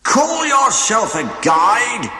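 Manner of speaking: high, loud, slow furious shout; angry yell. A narrator's reading. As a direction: yell it at the useless guide. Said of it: "he yelled".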